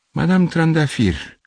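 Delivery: high, drawn-out name; sudden exclamation. A narrator's reading. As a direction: exclaim as a memory strikes. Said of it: "exclamă el".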